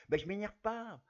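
hammed-up Depardieu snapping back; Gérard Depardieu impression. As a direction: protest like Depardieu, loud. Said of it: "je vais faire la voix de Gérard Depardieu".

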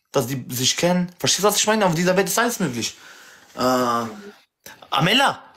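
usual speaking voice

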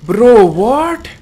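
baffled gamer reaction; incredulous. Real, not acted. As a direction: stretch 'what' upward in disbelief.

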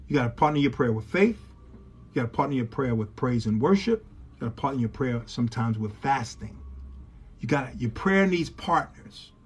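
low, soft pulpit teaching; hushed preaching. Real, not acted. Preacher counsels congregation on partnering prayer, hushed preaching. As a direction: bring it right down, measured and quiet.

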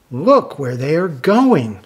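plain reading voice

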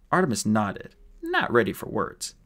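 plain reading voice

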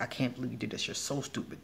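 low, soft self-reproach; retold self-berating. Speaker showing how she berated herself in her head, hushed scolding. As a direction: imitate an inner voice putting you down, quietly. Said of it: "sit there and berate myself".

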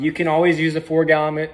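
ordinary talk

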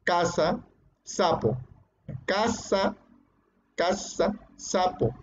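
casa and sapo both said with the same s sound, softly; teacher softly demonstrating that c and s sound alike; seseo demo. Shown as a demonstration. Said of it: "igual pronunciamos como S".

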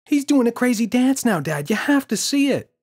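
put-on very high, loud child voice; excited child. Boy telling father the gorilla dances, excited. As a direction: gush it like a thrilled kid.

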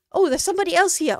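gasp, then a loud, high burst of surprise; startled gasp. Real, not acted. Streamer startled when a viewer shows up, loud gasp. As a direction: caught off guard by a new arrival. Someone unexpectedly joins the stream.

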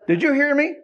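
high, loud parent's demand; exasperated parent. Acted. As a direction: call it out like a parent to a teenager. Parent checking on teenager, acted.

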